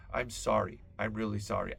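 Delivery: ordinary talk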